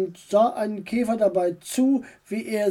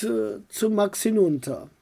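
ordinary delivery

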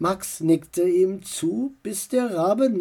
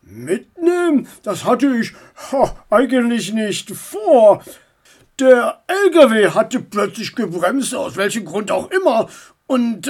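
bulldog driver voice, put-on; gruff driver; 2 semitones higher, 3 dB louder than usual. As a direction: play the bulldog trucker, grumbling along.

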